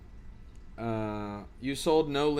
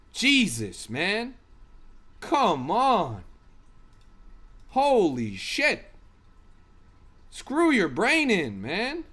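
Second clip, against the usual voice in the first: exasperated; 4 semitones higher, 3 dB louder, much slower than usual. Real, not acted. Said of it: "I'm getting worked up right now".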